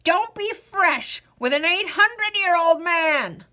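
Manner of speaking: put-on old man snapping at the rapscallion; snapped. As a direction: scold the cheeky youngster sharply. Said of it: "snapped the old man".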